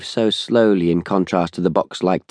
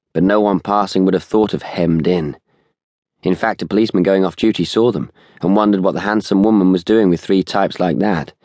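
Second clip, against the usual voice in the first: ordinary delivery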